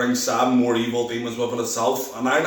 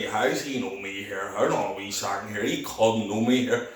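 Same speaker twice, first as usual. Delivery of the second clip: puzzled inner thought; 4 semitones lower, 5 dB quieter than usual. Retold or imitated. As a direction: drop the voice as if thinking privately. Retold bewildered inner thoughts.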